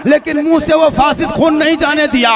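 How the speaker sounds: high, loud proclamation; God addressing His servants. Acted. God telling His servants of the womb, proclaiming loudly. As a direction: call out to the servants, drawing out the opening. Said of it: "और ये कहा".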